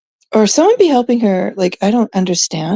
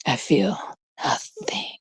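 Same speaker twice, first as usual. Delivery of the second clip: mock cold whisper; 12 dB quieter, slower than usual. Mock icy confession.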